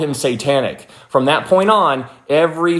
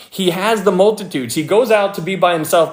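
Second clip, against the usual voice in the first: ordinary talk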